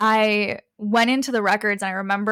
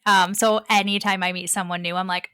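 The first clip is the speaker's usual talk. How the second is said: ordinary talk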